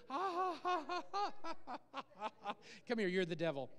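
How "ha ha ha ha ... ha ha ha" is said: God's laugh. Acted. Preacher acts out God laughing in heaven, hearty mock laughter. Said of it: "God is sitting in heaven laughing".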